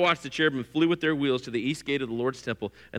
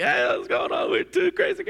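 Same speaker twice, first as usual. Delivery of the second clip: rowdy shout. Retold or imitated. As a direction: whoop it up like two clowns. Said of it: "Howie and I would just be like".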